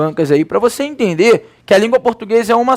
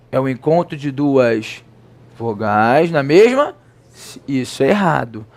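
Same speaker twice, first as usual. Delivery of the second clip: ordinary talk, nothing marked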